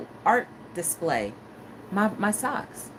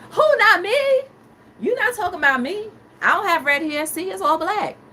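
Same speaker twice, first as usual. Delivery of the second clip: mock innocent denial; 8 semitones higher, 6 dB louder than usual. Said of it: "And I'm like".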